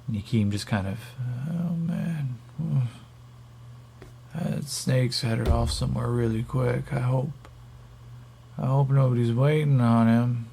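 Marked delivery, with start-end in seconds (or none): 1.5-10.5 stoned captive. Acted. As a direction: play a dazed captive, voice pitched up. Stoned captive's first reaction in game.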